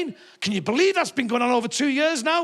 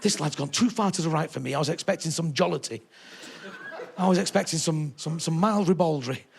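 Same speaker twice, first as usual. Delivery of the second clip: lightly voiced shocked older woman, lower and softer; a hint of a scandalised audience member whispering to her friend; prim old lady; 5 semitones lower, 5 dB quieter than usual. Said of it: "nudging each other going".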